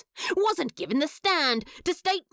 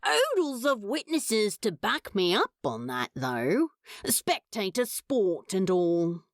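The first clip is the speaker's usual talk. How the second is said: a lightly lowered, sulky demon voice; Crowley, sullen then brightening; 8 semitones lower than usual. Acted. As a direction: grumble the complaint, then cheer up a little. Crowley voiced in fic reading.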